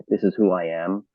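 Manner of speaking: usual speaking voice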